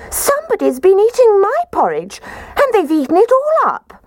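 high squeaky little bear voice; squeaky Baby Bear. Acted. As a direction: squeak it out like a tiny bear cub. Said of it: "positively squeaked".

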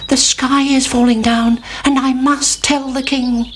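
high anxious chick. A narrator's reading. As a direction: cry out like a worried chick. Chicken Licken alarmed.

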